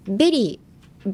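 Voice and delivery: "ベリー" drawn out with a B; fruit berry. Shown as a demonstration. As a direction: slowly pop the lips open on "ベリー". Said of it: "果物のベリーにならないように".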